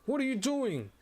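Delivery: high, sharp shout of outrage; doctor's shout. Acted. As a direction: yell at him to stop. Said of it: "the doctor shouts at him".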